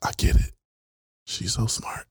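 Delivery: whisper. Retold or imitated. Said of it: "you're like".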